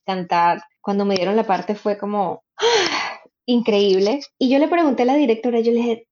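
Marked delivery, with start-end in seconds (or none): 2.6-3.1 high sharp intake of breath; re-enacted amazed gasp; awed gasp. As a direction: gasp as if just given the dream role. Acts out reaction to getting part.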